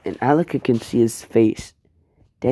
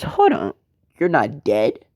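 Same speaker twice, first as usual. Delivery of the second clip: shocked disbelief; 3 semitones higher than usual. Acted. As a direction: gasp it out, can't believe he's alive. Deku shocked Killua is alive.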